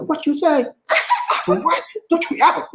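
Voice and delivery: high, indignant retold snap; half-hearted mock anger; mock outrage. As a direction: play the offended reaction. Retold angry reaction, acted.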